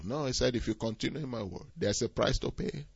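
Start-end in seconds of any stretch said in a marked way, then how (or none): 0.6-1.7 softer, quick murmur of If you continue in my word; tossed off softly and fast; quiet aside. Shown as a demonstration. Preacher quietly reciting the verse before booming it. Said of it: "If, there's a condition there".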